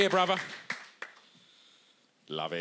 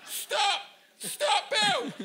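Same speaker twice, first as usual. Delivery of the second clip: much higher, louder yelling after him; laughing megaphone yell, far higher and louder; megaphone shout; 5 dB louder, much slower than usual. Retold or imitated. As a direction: bellow down a megaphone at a man running away. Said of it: "Like, through this megaphone".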